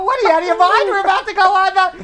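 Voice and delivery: loud, high and fast outburst; exasperated yell. Retold or imitated. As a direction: shout it in disbelief. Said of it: "And I go".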